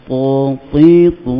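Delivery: heavy "Ta, ti, tu", one by one; emphatic ta syllables. Shown as a demonstration. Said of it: "Dia sebut".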